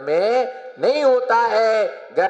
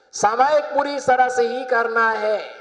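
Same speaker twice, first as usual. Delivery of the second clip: emphatic repeat; 3 semitones higher than usual. Real, not acted. Preacher restating the rule to the congregation, emphatic.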